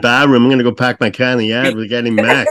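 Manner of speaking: teasing Boston imitation, r's dropped; hammed-up Bostonian; mock Boston accent. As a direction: put on a broad Boston accent. Host mimics her Boston accent.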